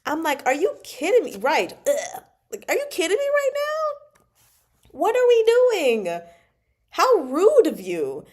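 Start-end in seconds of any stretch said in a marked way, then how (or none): 0.5-1.4 outraged disbelief. Retold or imitated. Reader outraged at rude character. 2.7-8.2 high-pitched, loud scolding, stretched out; incredulous outrage. Real, not acted. Reader scolding a disrespectful character, outraged.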